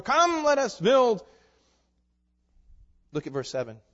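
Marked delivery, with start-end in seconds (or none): 0.0-1.3 slow, loud grand call; parroting builders. Retold or imitated. Preacher voices Babel's builders, slow and grand. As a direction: proclaim slowly and loudly. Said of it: "they kept saying".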